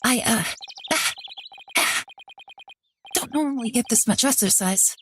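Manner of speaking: breathless and halting from exertion; out of breath. Acted. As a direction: puff between the words, winded. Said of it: "I'm all out of breath".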